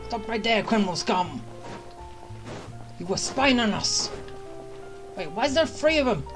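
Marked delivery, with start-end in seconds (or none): none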